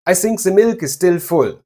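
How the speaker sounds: German accent with light L's and s for th. Shown as a demonstration. Teacher shows a telltale sign, in a German accent. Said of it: "telltale signs of a German accent in English".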